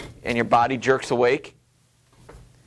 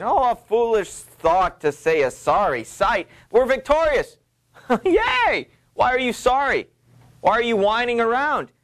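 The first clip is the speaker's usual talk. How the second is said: much higher, triumphant and mocking; a hammed-up triumphant wife; gleeful scoffing Lady Macbeth; 9 semitones higher than usual. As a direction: cheer the victory and tease his whining. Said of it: "Lady Macbeth scoffs, celebrates".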